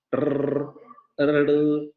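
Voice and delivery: ordinary talk, nothing marked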